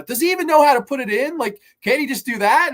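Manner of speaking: mock exasperated announcer; megaphone woman. Woman on megaphone mocking clueless guys, shrill rant. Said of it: "woman gets on a megaphone".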